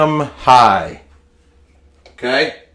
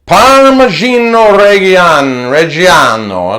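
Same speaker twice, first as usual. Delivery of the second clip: high, loud, hammed-up Italian accent; terrible Italian accent; 6 semitones higher, 5 dB louder than usual. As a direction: put on a bad Italian accent, rolling it out big. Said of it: "doing a terrible accent".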